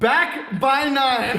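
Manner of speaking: slow shouted title, laughing; shouted slowly. Shown as a demonstration. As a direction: call out the name slowly with a laugh. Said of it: "What is it called?".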